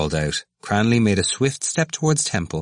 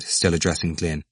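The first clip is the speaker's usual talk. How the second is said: usual speaking voice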